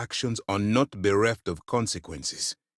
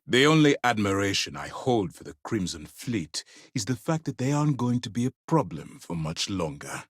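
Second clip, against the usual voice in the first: normal talking voice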